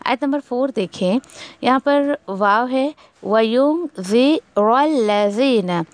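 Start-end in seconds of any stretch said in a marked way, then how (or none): 3.2-5.8 व युनज़िरल्लज़ीना recited slowly with a falling end; slow recitation of व युनज़िरल्लज़ीना; tajweed recitation. Shown as a demonstration. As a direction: hide the नून before ज़ in व युनज़िरल्लज़ीना. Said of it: "इख्फा करें आप".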